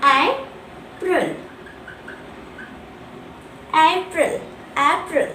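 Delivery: April drawn out in two syllables, then repeated; model pronunciation. Shown as a demonstration. Teacher shows how April will be pronounced, careful model. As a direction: say April slowly and clearly, AP-ril. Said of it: "it will be pronounced as".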